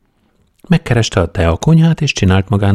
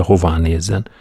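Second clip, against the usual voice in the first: plain reading voice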